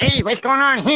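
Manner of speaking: high loud zany greeting; Ace Ventura. Retold or imitated. Comedian answers a fan's request, a quick zany Ace Ventura. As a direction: blurt it in a manic cartoon voice. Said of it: "can you do an Ace Ventura impression".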